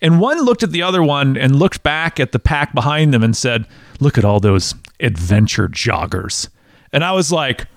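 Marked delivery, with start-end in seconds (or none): 4.0-6.5 low, mock-disdainful runner's voice. Serious runner sneering at the pack behind, mock disdain. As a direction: look down on the slowpokes, voice dropped low. Scornful runner quoted in story.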